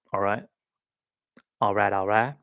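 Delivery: put-on a loose, half-hearted stab at a celebrity accent; Matthew McConaughey. Vlogger mocking an Art of Living ad, bad celebrity accent. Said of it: "Matthew McConaughey".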